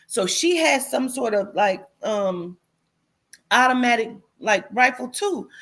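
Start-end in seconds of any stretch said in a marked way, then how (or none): none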